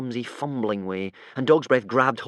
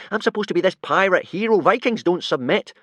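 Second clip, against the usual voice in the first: indignant; 9 semitones higher, 6 dB louder than usual. A narrator's reading. Hiccup refusing to submit, indignant. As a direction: refuse with wounded pride. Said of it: "said Hiccup indignantly".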